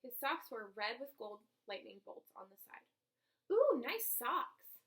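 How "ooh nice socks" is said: slow, loud, drawn-out ooh of admiration; hammed-up impressed kid; admiring coo. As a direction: gush over the socks. Classmate admiring the socks.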